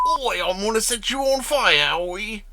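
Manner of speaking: a badly faked cockney Pyro; British cartoon accent. Admits he can't do Pyro, cockney attempt. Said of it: "I can't do his accent from the cartoon".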